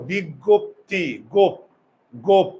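"বিজ্ঞপ্তি, গোপ" slow, with a rounded o; closed o in গোপ. Shown as a demonstration. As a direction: sound out "গোপ" with a closed-jaw o. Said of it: "আমরা কি গপ বলি?".

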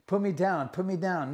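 half-strength imitated cry for release; retold scream. Key grip dragged up to ceiling, retold yelling. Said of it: "and I start screaming".

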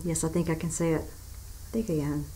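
soft, trailing self-encouragement; quiet self-talk. Real, not acted. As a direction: murmur it to yourself. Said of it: "quietly reassuring herself".

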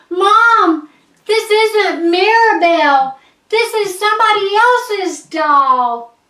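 put-on loud, high-pitched child's alarm; upset, high wail of protest; distressed outcry. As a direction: cry out to a parent in panic. Child discovers wrong doll, tells Mom.

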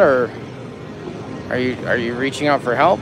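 ordinary talk, nothing marked

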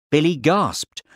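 usual speaking voice

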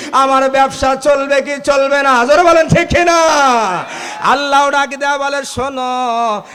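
high, loud chanted sermon cry; preaching at full force. Acted.

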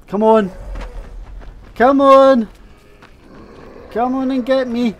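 hollering at a monster to come get him; shouted taunt. Real, not acted. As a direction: yell to draw it toward you. Calling the monster over.

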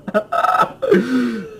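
high giggle after the fright; nervous giggle. Real, not acted. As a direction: giggle through nerves. Risa nerviosa. (risita nerviosa)

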